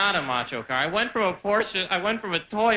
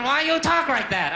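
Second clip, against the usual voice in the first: Chinese waiter; 10 semitones higher, 4 dB louder than usual. Retold or imitated. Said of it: "the waiter's going".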